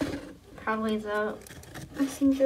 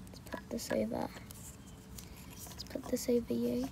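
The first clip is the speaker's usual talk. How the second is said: whisper; 11 dB quieter, faster than usual. Pretend. Sneaking up on monster.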